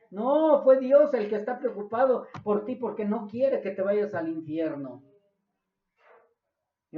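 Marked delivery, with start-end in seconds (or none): 0.1-5.0 forceful rebuttal. Real, not acted. Preacher rebuts the excuse.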